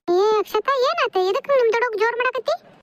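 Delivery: high-pitched reproachful questioning; a high, reproving "what's this?"; scolding challenge. Acted. Mother scolds daughter for raised voice.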